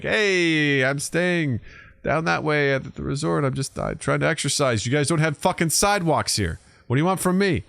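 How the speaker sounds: hammed-up exasperation; mock defiant retort. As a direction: tell off the imagined truck guy. Imagined defiant reply to locals.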